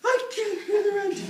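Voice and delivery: very high, loud laughing damsel wail; melodrama damsel. Pretend. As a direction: wail it as a helpless heroine.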